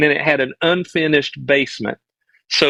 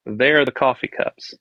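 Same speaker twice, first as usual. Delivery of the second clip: ordinary delivery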